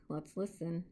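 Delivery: usual speaking voice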